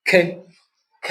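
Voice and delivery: usual speaking voice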